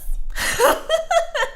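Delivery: bursting out laughing; big high laugh at her own joke; loud laugh. Real, not acted. Flute streamer to chat, big laugh.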